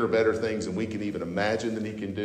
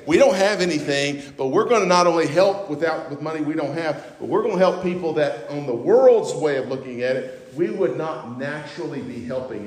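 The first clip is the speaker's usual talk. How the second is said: higher, loud fervent proclamation; fervent preaching; 6 semitones higher, 6 dB louder than usual. Acted. Preacher voicing the poor churches' vow, loud and fervent. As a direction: proclaim their resolve with fire. Voices Macedonian churches resolving.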